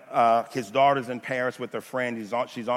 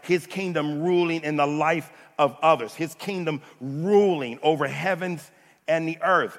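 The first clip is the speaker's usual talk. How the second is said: emphatic, rhythmic preaching in a raised voice; full-force preaching; 3 semitones higher, 4 dB louder than usual. Real, not acted. Preacher telling congregation of God's kingdom, full-force preaching. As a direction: drive each repeated phrase home.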